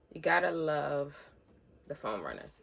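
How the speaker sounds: normal talking voice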